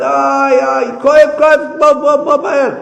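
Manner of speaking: loud, high, urgent yelp for help; cry of pain. Acted. Man with bellyache calling the remedy seller, pained shouting. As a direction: yell in agony for someone to hurry over.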